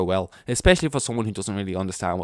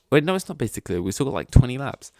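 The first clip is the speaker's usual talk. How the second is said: sudden realisation; 4 semitones higher, 6 dB louder, faster than usual. Retold or imitated. Acts out sudden realisation during race.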